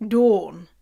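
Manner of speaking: "Dawn" with a long aw; British Dawn. Shown as a demonstration. Repeating the name after spelling it, long-vowel "Dawn". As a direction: round and lengthen the vowel of Dawn. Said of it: "Canadians say Don".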